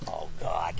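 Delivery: low, soft, sinking groan; quiet, deep mock despair; dreading. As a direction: mutter it very low in retold dread. Suitor dreading the woman's answer.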